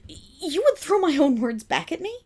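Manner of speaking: affronted outburst; flustered indignation. Acted. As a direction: stammer in offended surprise. Offended at being mocked.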